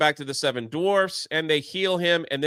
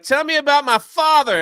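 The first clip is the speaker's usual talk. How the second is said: higher and louder, insisting on an answer; demanding; 9 semitones higher, 7 dB louder than usual. Retold or imitated. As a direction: push hard for the truth.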